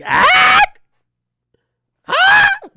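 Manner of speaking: slow, very loud and high cry; outraged shout. Retold or imitated. Narrator shouting at his date about bringing another man. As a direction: yell the question in disbelief. Yelling at girlfriend.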